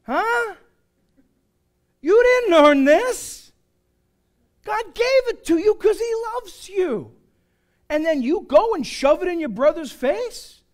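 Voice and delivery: loud, high, drawn-out Italian indignation; mock Italian accent. Preacher imagining an Italian Paul, mock Italian outrage. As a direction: scold like a hammed-up Italian. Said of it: "If Paul was Italian, he'd be like".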